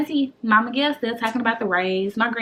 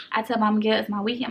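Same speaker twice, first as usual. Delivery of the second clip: ordinary talk, nothing marked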